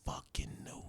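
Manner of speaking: gloating whisper; whispered I-knew-it. Retold or imitated. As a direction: whisper it with smug glee. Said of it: "I was like".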